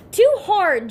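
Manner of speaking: put-on loud, high kid's yell. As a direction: yell it out in protest. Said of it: "Joe yells".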